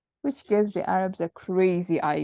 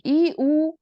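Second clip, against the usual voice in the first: a high, louder monkey hoot; monkey sound; 7 semitones higher, 5 dB louder than usual. Acted. The monkey offering the Arabs a sound, hooting high and loud. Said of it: "the monkey was like".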